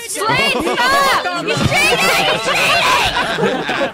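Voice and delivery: high, loud accusing yell breaking into laughter; real laughing shout; laughing outrage. Real, not acted. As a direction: call out the cheater, half laughing. Accusing a friend mid-race.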